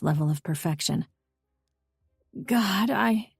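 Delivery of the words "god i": breathy moan of pleasure. A narrator's reading. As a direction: start to moan it. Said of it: "I began to moan".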